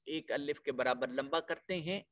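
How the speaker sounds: ordinary delivery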